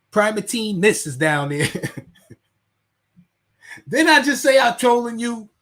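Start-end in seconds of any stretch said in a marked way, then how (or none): 3.9-5.5 louder and higher, scolding the chat; calling out a silly commenter; exasperated raised voice. Real, not acted. Streamer scolding a commenter in chat, exasperated raised voice.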